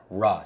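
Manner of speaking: "R" sounded out as ruh. Shown as a demonstration. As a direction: say "R" as an r with uh after it. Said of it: "Make the R sound".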